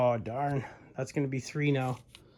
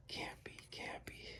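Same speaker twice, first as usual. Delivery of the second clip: whispering; 19 dB quieter than usual. Real, not acted. Disbelief muttered at bad reading.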